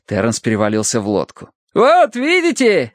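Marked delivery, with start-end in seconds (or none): 1.7-2.9 high, drawn-out crowing; triumphant. A narrator's reading. Terence celebrates getting aboard, triumphant. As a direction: announce it in triumph. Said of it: "торжествуя возвестил он".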